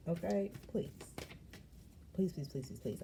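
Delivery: ordinary talk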